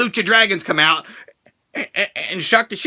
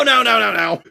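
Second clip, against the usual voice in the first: ordinary delivery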